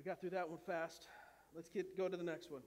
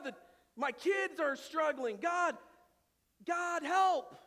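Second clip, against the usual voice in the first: loud pleading cries to God; desperate prayer; 7 semitones higher, 11 dB louder than usual. Acted. Pastor acting a churchgoer begging God, loud and pleading. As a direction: beg God for help, voice raised. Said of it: "We don't come saying".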